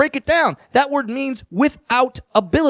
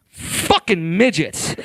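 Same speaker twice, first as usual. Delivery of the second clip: slow, grumbling mock annoyance; muttered slow curse; 4 semitones lower, much slower than usual. Annoyed ATM user grumbling at short people, slow mock curse. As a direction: drag out the curse like an annoyed customer. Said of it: "you'll walk up and be like".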